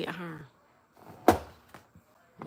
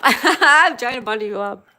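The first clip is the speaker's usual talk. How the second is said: laughing; 4 semitones higher, 11 dB louder than usual. Real, not acted. Joking reply to flattery.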